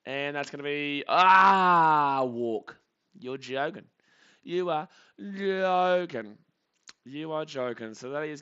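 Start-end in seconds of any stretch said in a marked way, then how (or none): 1.1-2.2 long, loud frustrated "aah"; drawn-out cry of dismay, much louder; frustrated outcry. Real, not acted. Commentator reacting to a walk, frustrated outcry. Groans at a walk being given. 4.4-6.4 slow, high and loud, stretching "joking"; exasperated, dragging the words right out; drawn-out disbelief. Real, not acted. Fan reacting to a walk, drawn-out disbelief.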